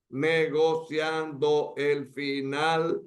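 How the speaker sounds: slow, every syllable "negociando el final" pulled apart; counting syllables. Shown as a demonstration. Teacher counting grammatical syllables for the class, slow and separated. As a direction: spell out "negociando el" syllable by syllable, slowly. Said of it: "si contamos cuántas sílabas gramaticales tiene".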